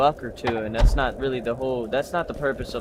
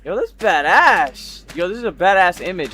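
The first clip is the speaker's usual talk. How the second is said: loud, high, hyped up; excited; 7 semitones higher, 6 dB louder than usual. Real, not acted. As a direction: hype it like a thrilled gamer. Thrilled by game image.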